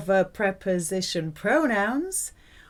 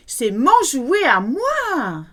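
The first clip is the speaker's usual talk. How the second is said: louder, slow, whiny possessive swoops; spoilt child; 4 semitones higher, 7 dB louder, slower than usual. Shown as a demonstration. Teacher demonstrating emphasis with a toy, spoilt child insisting. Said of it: "Sounds a bit like a spoilt child".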